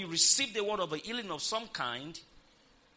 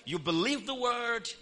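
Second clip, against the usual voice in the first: higher, raised preacher's prompt; call for repetition; 4 semitones higher, 4 dB louder than usual. Shown as a demonstration. Preacher leads the congregation in repeating, higher and raised. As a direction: call it out for the crowd to echo. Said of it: "Somebody say you acknowledge the word".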